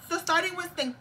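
lingering Spanish accent. Real, not acted. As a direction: still stuck in a Spanish accent. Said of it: "let's get my voice".

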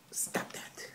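whispered telling-off; whispered scolding to the pet. Real, not acted. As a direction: hiss it quietly at the pet.

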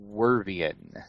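"Wervian" said WUR-vee-un; own pronunciation of wyvern. Shown as a demonstration. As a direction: say "Wervian" with a first syllable like "were", then "vee-un". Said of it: "is how I pronounce it".

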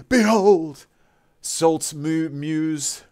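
booming high proclamation, drawn out; theatrical announcer. Acted. Streamer hails a new subscriber like a herald, booming. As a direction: announce each gift like a herald.

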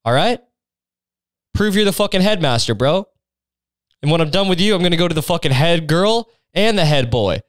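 high, pushy mock confrontation; brash challenge. Student dares the headmaster to prove himself, brashly mocking. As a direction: goad him with cocky, comic insistence.

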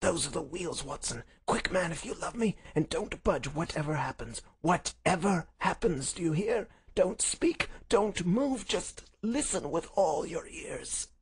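high, tense, purposeful orders; masterful urgency. Acted. Holmes orders his friend to hide as wheels arrive, urgent command. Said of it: "his masterful, purposeful talk".

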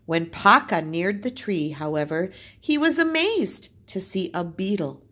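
put-on lively read-aloud for children; story narration. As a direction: tell the tale to the class, bright and clear. Teacher reads folk tale aloud.

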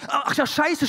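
loud, high startled curse; sudden shout on remembering the gig. Retold or imitated. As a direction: yell it as it hits you. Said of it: "schrie ich".

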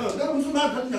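taunting boss. Retold or imitated. Acted quarrel, boss taunting.

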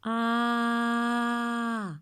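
А sung long, mouth wide open. Shown as a demonstration. Teacher modelling the vowel for kids. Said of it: "Ротик відкриваємо широко".

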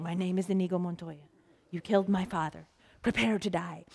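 half-hearted Spanish movie swordsman; mock Spanish accent, low and understated; bad Spanish accent. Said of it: "in a bad Spanish accent".

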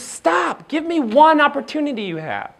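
high-pitched imitation of insistent wife; acted wifely command; firm coaching wife. Retold wife cuts off his complaining.

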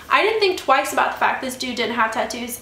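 ordinary delivery

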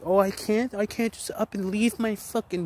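high, whiny mock complaint; sneering impression of a complainer; mocking whiner. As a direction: mimic a whining excuse-maker. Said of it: "mock whiny excuse of city people".

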